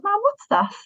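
high-pitched imitation of a three-year-old; put-on high toddler voice; small child. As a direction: ask it like a curious little kid. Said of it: "explain this to a three-year-old".

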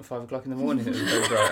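laughing. Real, not acted. Mocking misunderstanding, laughing.

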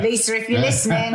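teasing absent friend Lisa about swearing, laughing. Real, not acted.

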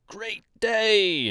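high, bright villager greeting; cheery Munchkin call. Acted. Munchkins greet the passing travelers, high cheery call. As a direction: pipe up from a window. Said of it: "shouted things like".

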